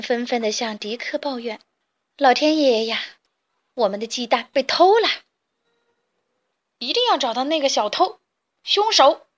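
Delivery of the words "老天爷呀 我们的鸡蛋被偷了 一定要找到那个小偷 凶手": outraged shouting. Acted. Hens shout about their stolen eggs, rising outrage. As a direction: cry out for the thief.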